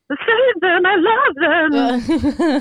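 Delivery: high, very slow, laughing wail; laughing mock plea to save them; mock sincere plea. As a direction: overplay the earnest heroine. Mocking sincere characters.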